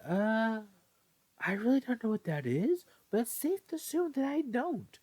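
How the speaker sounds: put-on high, puzzled young voice; confused reply. Ren answers the old man, high and confused. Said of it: "he would reply confusingly".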